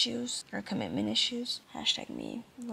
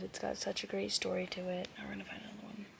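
soft hushed whisper; 3 semitones lower, 8 dB quieter than usual. Real, not acted. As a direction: whisper so nobody wakes. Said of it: "I have to whisper".

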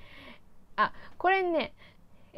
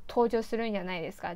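normal talking voice

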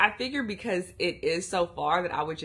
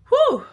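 shaky exhale; 13 dB louder than usual. Real, not acted. Steadying herself after tears.

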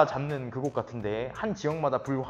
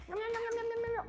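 munching noise; 6 dB quieter than usual. Pretend. Mock eating noise at characters.

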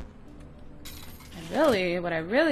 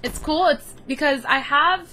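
excited; 6 semitones higher, 6 dB louder than usual. Real, not acted. Defensive excited explaining.

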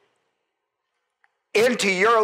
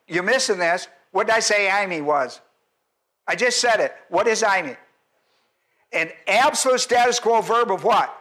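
normal talking voice

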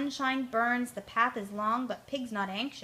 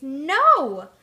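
much louder scream; 4 semitones higher, 13 dB louder than usual. Acted. Soaked Pig screams at laughing friends, loud. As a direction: blast the no out. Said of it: "The pig screams".

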